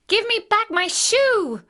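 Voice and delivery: put-on high, loud angry girl's demand; angry little girl. A narrator's reading. Girl to the witch who took her shoe, angry. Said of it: "grew angry and said".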